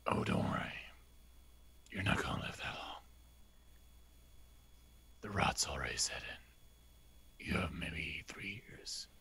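cold threatening whisper; menacing whisper. Acted. Menacing a dying mother.

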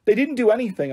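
high, frantic denial. A narrator's reading. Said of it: "I said frantically".

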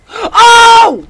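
loud, high startled cry; sudden shout of alarm; startled yell. Real, not acted. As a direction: yelp as if caught off guard. Startled by pursuer in game.

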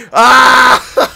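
short, high, loud anguished yell; a pained shout at the screen; frustrated yell. Real, not acted. Gamer reacting to the tying goal, anguished yell.

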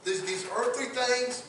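lightly voiced Daniel refusing the king; defiant Daniel. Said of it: "I can see Daniel looks at him and says".

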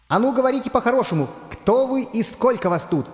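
high, insistent little iron man; determined high-pitched challenge; resolute demand. Acted. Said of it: "решительно заявил Железный Человечек".

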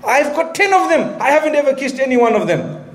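high, scoffing voice of a proud father; mocking boastful man. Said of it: "He says".

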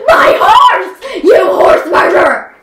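furious yell, pitch jumping high; angry shouting. Pretend. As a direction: scream at the horse killer. Furious about a killed horse.

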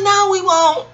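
mock crowd reply; imitating the audience. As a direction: chirp it like a crowd agreeing. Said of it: "They was like".

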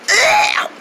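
high, hoarse "ugh"; disgusted groan. Acted. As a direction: recoil with a strained squeak. Disgust at the soup.

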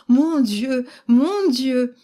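put-on an old man beside himself with happiness; overjoyed exclaiming. A narrator's reading. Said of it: "courir égaré dans la chambre en faisant". (le vieux, éperdu de joie, s'exclame plus haut et plus fort)

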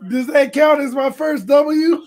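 high, loud, disbelieving shout; shouting to the chat in disbelief; excited shout. Real, not acted. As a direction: yell the question in delight. Unsure the win counts.

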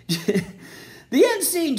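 "the end scene" said slowly through a laugh. Real, not acted. Comic repeats "the end scene" to the audience, laughing. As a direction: crack up while saying "the end scene".

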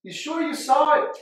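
high incredulous questioning; doubting a friend's story, high and loud disbelief; skeptical. Retold or imitated. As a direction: press him like you don't buy it. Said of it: "Aaron, and be like".

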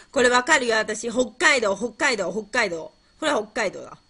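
loud, eager quiz answer; excited certainty. Real, not acted. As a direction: blurt it out, sure you know it.

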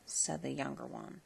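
ordinary delivery

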